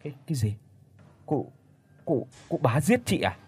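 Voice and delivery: stammering in disbelief, a touch of fear; shocked stammer. A narrator's reading. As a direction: trip over the words, stunned. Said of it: "lắp bắp không có thành lời".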